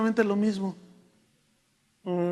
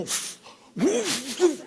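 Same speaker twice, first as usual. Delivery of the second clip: imitated blowing; 6 semitones higher, much slower than usual. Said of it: "oí algo así como".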